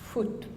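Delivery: foot said with a tense oo like food; foot with the vowel pushed up and forward. Shown as a demonstration. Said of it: "O becomes U".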